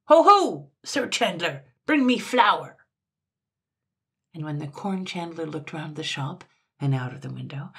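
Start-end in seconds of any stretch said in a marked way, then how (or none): 0.1-2.8 high, loud opening shout, then demanding order; loud summons; top-of-voice call. A narrator's reading. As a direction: hail the shopkeeper imperiously. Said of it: "called out at the top of his voice".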